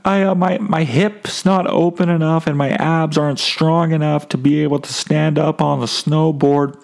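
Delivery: high calling-up voice; imagined yell up. Pretend. Answering the kid on the chairlift, pitched high as if calling up. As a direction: shout the excuse up to the lift. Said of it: "I couldn't just yell up to him".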